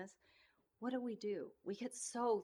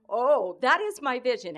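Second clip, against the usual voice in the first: joyful outburst; 3 semitones higher, 16 dB louder than usual. Real, not acted. Preacher overcome with joy.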